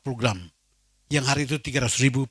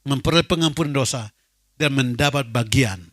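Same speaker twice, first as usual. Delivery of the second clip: louder scripture proclamation; fervent preaching; 6 dB louder than usual. Real, not acted. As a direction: preach the verse with conviction. (membacakan ayat dengan khotbah lantang)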